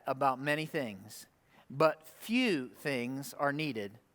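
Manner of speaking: low, gentle reply with long pauses; tender. Acted. Jesus gently correcting a worried Martha, tenderly. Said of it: "he very tenderly says".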